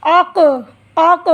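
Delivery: अक with its final क bounced out; bouncing echo. Shown as a demonstration. Teacher repeats the bouncing letter for students. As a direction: let the क of अक bounce up. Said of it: "आवाज़ को उछालना".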